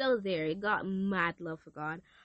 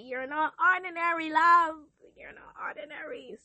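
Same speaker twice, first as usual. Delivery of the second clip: tender voice of God; 8 semitones higher, 5 dB louder than usual. Retold or imitated. God's tender reply.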